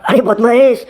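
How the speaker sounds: ordinary talk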